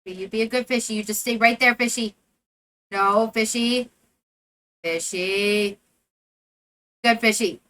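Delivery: loud, coaxing calls to the fishy. Real, not acted. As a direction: coax the fish to stay put. Coaxing a fish while fishing.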